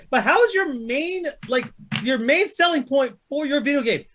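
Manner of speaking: ordinary talk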